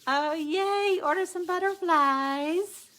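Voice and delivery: hammed-up excitement, dragged out slowly; mock cheer. Real, not acted. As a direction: cheer it like a game show host. Mock cheer at next step.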